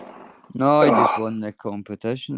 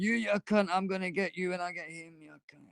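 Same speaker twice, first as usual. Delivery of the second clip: high, soft mock-furious wife over the doorbell; a lightly hammed-up angry woman, pitched up and hushed; imitating the wife raging under her breath through the doorbell speaker; 6 semitones higher, 10 dB quieter than usual. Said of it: "you hear her on the thing going".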